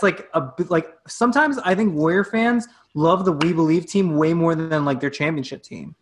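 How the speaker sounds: ordinary talk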